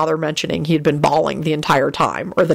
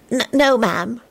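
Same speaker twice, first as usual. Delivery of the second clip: put-on nervous, higher-pitched stammering boy; 6 semitones higher, slower than usual. A narrator's reading. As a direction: stumble on the first word. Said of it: "Timothy stammered out".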